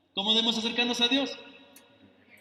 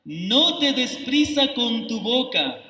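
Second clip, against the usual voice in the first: slow, louder, weighty reading of "acércate más"; solemn scripture reading; 2 semitones higher, 5 dB louder, slower than usual. Acted. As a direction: read "acércate más para oír" slowly, loud and solemn, as holy text. Reads scripture aloud solemnly. (lectura bíblica solemne, lenta y fuerte)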